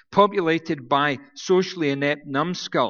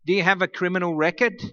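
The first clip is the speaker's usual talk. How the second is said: Aussie officer; 3 semitones higher than usual. Retold or imitated. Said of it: "one of the officers said".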